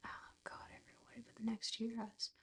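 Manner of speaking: very quiet, near-whispered aside; muttered aside. Real, not acted. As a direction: mutter it quickly under your breath. Muttering aside after losing track.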